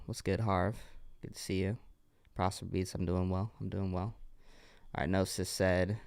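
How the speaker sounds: normal talking voice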